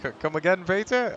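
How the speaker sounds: high, loud mock Cockney "come again"; Cockney accent, hammed up and high. Co-commentator teasing Peter in mock Cockney. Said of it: "you couldn't say that in a Cockney accent".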